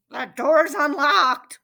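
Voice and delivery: loud, slow call from wolf playing granny; granny disguise. A narrator's reading. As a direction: call out slowly as a fake granny.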